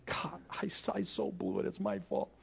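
very soft, sinking to a near whisper; humble confession. Acted. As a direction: own up in a hushed, contrite voice. Imagined humble confession by Adam.

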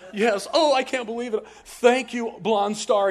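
loud, high-pitched imitation of an overjoyed caller; going crazy. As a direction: gush thanks like a hammed-up rescued motorist. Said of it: "she's going crazy".

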